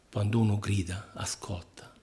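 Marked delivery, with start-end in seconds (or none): 1.1-1.9 soft whisper; sussurro. Real, not acted. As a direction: drop to a hushed whisper. Hushed repeat after "grida. (sussurrato piano)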